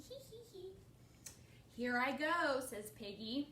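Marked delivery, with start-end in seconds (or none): 0.0-0.8 high, soft giggle read aloud; laughing. A narrator's reading. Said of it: "he's laughing". 1.8-2.6 loud, lifted storybook call; eager announcement. A narrator's reading. Piggy announces her turn.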